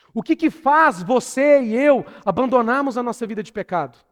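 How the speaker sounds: high, loud, driving repeated questions; impassioned preaching. Real, not acted. Preacher challenges congregation about holy living, impassioned. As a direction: build rhetorical questions with full-voiced fervour.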